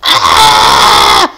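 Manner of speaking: very low, harsh roar of frustration; raging growl. Real, not acted. Player raging after dying, low growling yell. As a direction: let out a low, raging groan. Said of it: "I'm raging".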